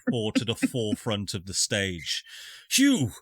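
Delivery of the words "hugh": higher, louder call to Hugh. Acted. Elder calling Hugh to speak to the crowd, loudly.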